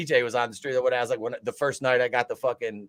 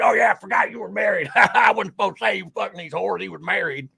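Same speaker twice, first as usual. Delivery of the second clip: high, loud laughing mock voice; hammed-up blurting buddy; mock drunk friend; 8 semitones higher, 3 dB louder than usual. Mimics friend's drunken confession.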